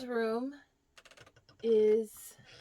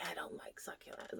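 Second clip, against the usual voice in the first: voiceless whispered quote; retold whispered confession; 18 dB quieter than usual. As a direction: keep the secret from the plant. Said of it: "And I say".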